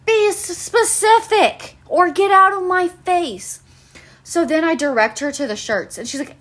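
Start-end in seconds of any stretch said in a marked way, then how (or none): 0.1-3.6 higher, slow, emphatic telling-off; exasperated rant. Acted. Worker snapping at a vague customer, exasperated. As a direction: stretch each word at the customer in exasperation.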